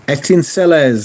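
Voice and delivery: "Étincelles" said the French way, nasal vowel and no final s; "Étincelles" pronounced in French, not English; French pronunciation. Shown as a demonstration. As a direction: say "Étincelles" as a French speaker would, ay-tan-SELL. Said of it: "sparks in French".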